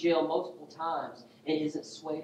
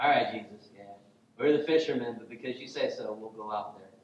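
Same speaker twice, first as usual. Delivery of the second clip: lower-pitched grudging agreement; sarcastic; 4 semitones lower than usual. Acted. Fisherman Peter humoring Jesus, sarcastic and resigned. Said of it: "I love the sarcasm".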